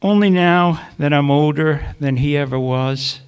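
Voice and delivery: measured poem reading with long pauses between lines; poetry reading. Acted. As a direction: read each line apart, letting it hang. Reads poem to audience.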